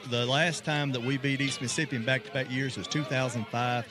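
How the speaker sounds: normal talking voice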